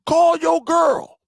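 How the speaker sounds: high, loud mock crowd yelling; mock crowd shout. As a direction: play the whole room hollering at him. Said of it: "Every avatar was like".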